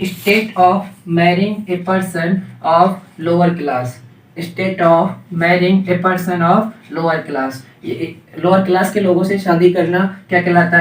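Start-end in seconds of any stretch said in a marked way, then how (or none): 0.0-7.5 slow dictation. Shown as a demonstration. Teacher dictating a definition.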